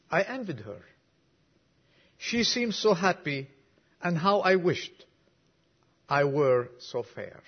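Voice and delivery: preacher reciting a moving poem to the congregation; poem recital. Acted. Reads a whole poem aloud.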